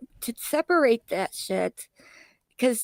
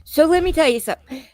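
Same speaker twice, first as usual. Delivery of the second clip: louder and higher, playful; loud joking boast; 5 semitones higher, 6 dB louder than usual. Real, not acted. Telling friend her family became hillbilly, loud and joking. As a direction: announce it loudly with a grin.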